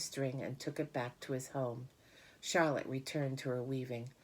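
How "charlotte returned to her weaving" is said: ordinary delivery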